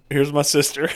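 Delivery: put-on half-done accent through laughter; laughing. Podcaster attempts a Samoan accent while laughing. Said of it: "I can't do a Samoan accent".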